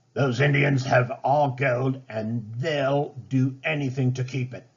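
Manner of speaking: Ratcliffe roaring about the gold; shouted. A narrator's reading. Greedy Ratcliffe accusing the Indians, shouting. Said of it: "Ratcliffe shouted".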